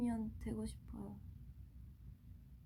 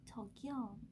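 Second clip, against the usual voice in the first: sexy version; 8 dB quieter, slower than usual. Shown as a demonstration. Idol attempting a requested sexy call, softly sultry. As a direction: murmur it softly, trying to be alluring. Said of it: "섹시하게 저기요?".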